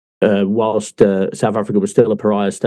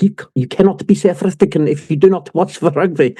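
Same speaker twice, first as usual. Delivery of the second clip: mock South African accent, higher and pushed; 6 semitones higher than usual. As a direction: put on a South African accent for the coworker's scolding. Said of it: "And he's like".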